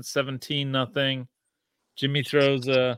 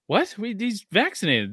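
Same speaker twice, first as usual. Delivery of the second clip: incredulous; 8 semitones higher than usual. Retold or imitated. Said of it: "I'm like".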